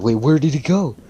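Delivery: high, loud baffled group outcry; confused. Retold or imitated. Classmates baffled by Deku vanishing, loudly confused. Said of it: "They're straight up fucking confused".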